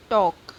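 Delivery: टॉक said with a long aw sound; model word demo. Shown as a demonstration. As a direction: stretch the aw in टॉक for the class. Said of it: "heavy dash लगेगा". (टॉक को लंबे ऑ के साथ साफ़ बोलना)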